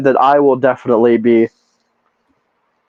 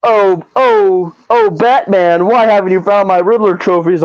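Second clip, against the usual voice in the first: mock Riddler taunt; 4 semitones higher than usual. Host mocks the Riddler nagging Batman, hammed-up taunt. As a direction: tease Batman like a smug puzzle villain. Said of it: "And then he's like".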